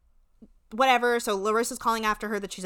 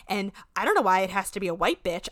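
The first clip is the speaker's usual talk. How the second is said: ordinary delivery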